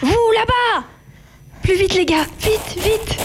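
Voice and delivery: high urgent yells to hurry; shouting. Acted. Guards spotted, urging escape.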